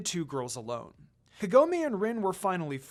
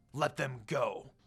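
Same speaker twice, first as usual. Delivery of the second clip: a slow, lower cold command; 5 semitones lower than usual. A narrator's reading. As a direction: threaten the monks calmly. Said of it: "he commanded".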